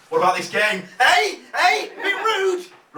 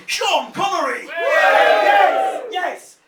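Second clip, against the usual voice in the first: rallying shout; 9 semitones higher, 5 dB louder, much slower than usual. Real, not acted. Comedy host rallies the crowd for Sean Connery, loud cheer.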